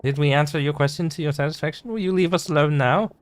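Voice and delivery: high, haughty society lady. Acted. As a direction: look down your nose, pitched high. Same lady dismissing them.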